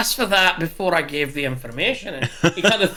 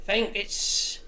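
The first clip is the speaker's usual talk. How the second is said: a barkeep slipping into an Irish lilt; Irish accent; 3 semitones higher than usual. Acted. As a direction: play it with a light Irish accent. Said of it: "I've gone Irish from Scottish".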